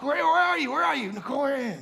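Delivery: retold panic, repeating the question; frantic calling out. Family searching the hotel room for mom, frantically calling.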